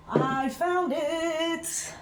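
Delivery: singsong. Pretend. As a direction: sing it out, drawn way out. Singsong triumph over duster can.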